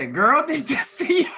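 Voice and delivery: high mocking question with a laugh; amused, incredulous squeal of a question; laughing cops. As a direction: imitate cops cracking up in disbelief. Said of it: "the cops laughed at him".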